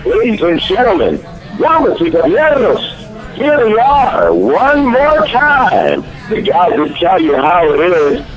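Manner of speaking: high, booming ring announcer intro; lucha libre announcer. Acted. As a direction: stretch the words like a fight announcer.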